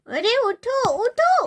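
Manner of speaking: high, rising call to wake up; urgent wake-up call. Acted. Sister rousing sleeping brother. (ज़ोर से जगाते हुए)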